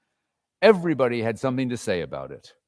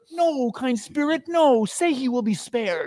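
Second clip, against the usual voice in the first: much higher, begging; desperate plea; 10 semitones higher than usual. Acted. Scrooge pleading with the spirit to spare Tim. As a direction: cry out against the fate.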